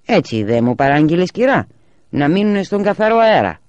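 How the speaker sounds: the milkman answers cheerfully and loud; milkman's voice. A narrator's reading. (ο γαλατάς απαντά εύθυμα και δυνατά)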